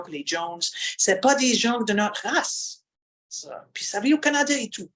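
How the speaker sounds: broad Acadian French reading; Acadian accent. Shown as a demonstration. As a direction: read it in a heavy Acadian accent. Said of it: "I'm gonna exaggerate a little bit today".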